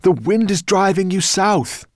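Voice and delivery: high, loud cry over the storm. A narrator's reading. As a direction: call out against the roaring wind. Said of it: "cried Ben".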